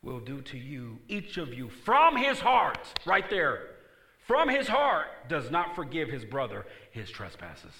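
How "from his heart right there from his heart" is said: high, loud, slow stress on heart; preaching at full force; emphatic. Acted. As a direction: drive the phrase home slowly.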